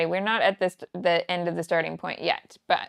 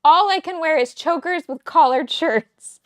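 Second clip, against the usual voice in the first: higher, louder mock panic; mock dramatic; 8 semitones higher, 7 dB louder than usual. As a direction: play it as a joking crisis, higher and louder. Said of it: "and I said".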